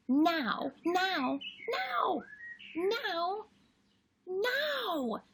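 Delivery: put-on high excited little girl voice, pleading; little sister Lola. Lola begging Charlie for her favourite book, high and pleading. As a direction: plead like an eager small girl. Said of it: "Then Lola says".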